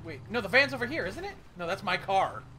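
normal talking voice

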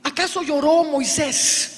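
ordinary talk

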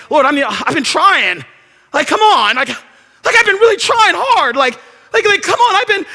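a worn-out servant begging to be seen; hammed-up frustrated Elijah; frustrated pleading. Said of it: "he's like".

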